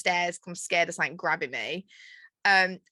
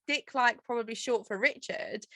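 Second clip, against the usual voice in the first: normal talking voice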